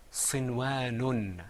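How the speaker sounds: sinwanun said slowly, each syllable clear; model pronunciation. Shown as a demonstration. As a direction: sound out sinwanun with the extra noon. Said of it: "Say that with me".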